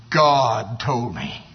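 put-on a calm, certain old man answering his neighbor; slow firm answer. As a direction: let every word land, steady and sure.

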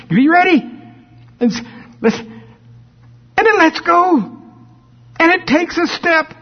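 high, eager countdown; excited anticipation. Retold or imitated. Parent waits for baby's first step, high eager voice. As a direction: hold your breath for the first step.

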